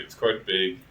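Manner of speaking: ordinary talk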